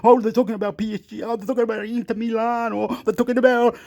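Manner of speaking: high-pitched, hammed-up gushing over European clubs; mock excited fans. Vlogger mimics fans from smaller countries talking about Europe, mock excited.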